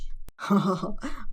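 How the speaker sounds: soft, low laugh; short subdued chuckle. A narrator's reading. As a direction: laugh it off quietly. Saeed laughs off her scolding.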